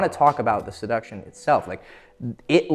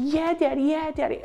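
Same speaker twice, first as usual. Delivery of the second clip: very high mock-feminine voice; high-pitched pretend swooning; imitated woman's reply. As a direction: squeak it out as a put-on female partner. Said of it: "And then she's like".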